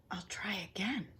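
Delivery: unvoiced whisper; whispering to himself after the wish fails. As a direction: breathe it under your breath. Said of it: "I whispered".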